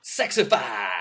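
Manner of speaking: high, loud ad-style exclamation; big punchy product reveal; product-name shout. Acted. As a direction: shout the brand name like a tagline. Announces the made-up drug name.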